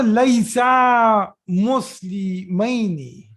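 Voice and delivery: slow, loud, drawn-out model reading; very slow, emphatic classroom modelling; drill model sentence. Shown as a demonstration. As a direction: say it loudly, stretching it out for learners.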